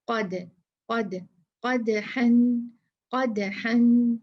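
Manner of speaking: क़दहन with the dal bounced, the last one slow; qalqalah on dal. Shown as a demonstration. Teacher showing students the dal echo. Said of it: "दाल की मिसाल".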